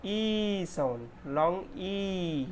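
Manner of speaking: ई stretched slowly; long ee. Shown as a demonstration. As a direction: draw out the ई long. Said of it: "ये है हमारा".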